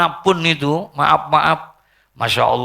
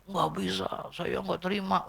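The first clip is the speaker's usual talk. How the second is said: mock tearful refusal; 7 semitones higher, 11 dB quieter than usual. Acted widower refusing to accept loss.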